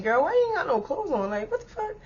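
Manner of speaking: high, dropping to a near-hushed disbelief; half-whispered shock at her friend; incredulous question. Retold or imitated. Asking Susie about her clothes, hushed high disbelief.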